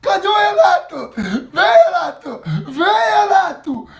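loud laughing taunt; gloating taunt. Real, not acted. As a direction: call the rival out, gloating. Taunting rival after winning.

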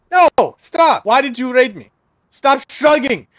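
loud, demanding yell; angry shouting. Real, not acted. Raider shrugging at him.

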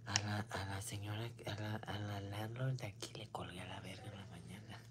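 hushed confiding whisper; breathless unvoiced aside. Real, not acted. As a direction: lean in and whisper the gossip. Confiding about hanging up on landlady.

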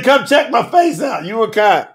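normal talking voice

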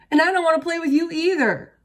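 high, loud, fast retort; shouting back. A narrator's reading. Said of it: "Franklin shouted back".

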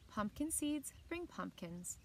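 plain reading voice

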